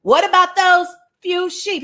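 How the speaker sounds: high, scolding challenge; acted-out sibling scolding; angry. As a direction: needle him like an annoyed big brother. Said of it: "he was angry".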